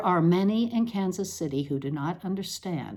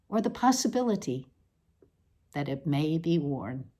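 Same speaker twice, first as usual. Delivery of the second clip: normal talking voice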